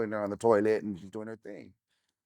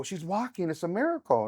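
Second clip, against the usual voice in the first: higher, overjoyed retold exclamation; husband's amazed cry, pitched up; excited exclaiming; 7 semitones higher than usual. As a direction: replay the thrill of seeing her walk. Husband exclaims wife can walk.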